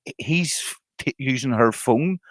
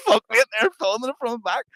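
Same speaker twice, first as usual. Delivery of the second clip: mock alarmed mate; 11 semitones higher than usual. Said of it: "D's bouncing on him like".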